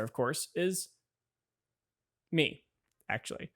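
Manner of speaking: loud, bright-toned show-host intro; upbeat host read. Acted. Host welcoming viewers to his channel, upbeat announcer voice. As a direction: sell the channel like a YouTube host.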